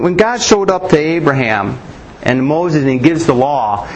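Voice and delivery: normal talking voice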